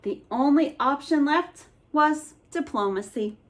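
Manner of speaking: plain reading voice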